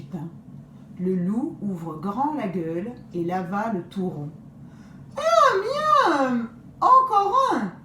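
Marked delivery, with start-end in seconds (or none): 5.2-7.7 much higher, loud greedy wolf cry. Acted. Wolf begging grandmother for another bun, loud greedy cry. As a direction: howl for more like a glutton. Wolf gobbling, wants more.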